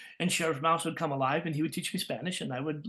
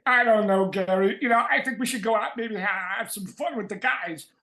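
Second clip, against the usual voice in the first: higher, louder toy character voice; talking toy football; 5 semitones higher, 5 dB louder than usual. Acted. Mister Football answering Gary, playful character voice. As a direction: answer as a lively cartoon football. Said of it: "So I couldn't do those voices".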